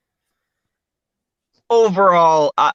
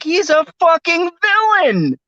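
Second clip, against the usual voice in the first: incredulous outburst. Retold or imitated. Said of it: "And I'm like".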